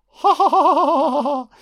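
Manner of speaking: high, loud mad laugh; rapid hammed-up maniacal cackle; possessed cackle. Woman possessed in a film, mock crazed high laughter. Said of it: "It's possession times fucking a hundred".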